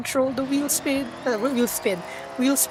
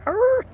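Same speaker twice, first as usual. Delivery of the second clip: loud high pirate arr; 13 semitones higher, 6 dB louder than usual. Pretend. Streamer yelling a pirate arr at chat.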